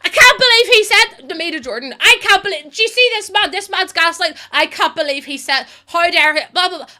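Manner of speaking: high, loud, outraged rant. Retold or imitated. Pausing the show to rant to Jordan, high outraged shouting. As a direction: shout your outrage at the screen. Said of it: "this was me, with my remote".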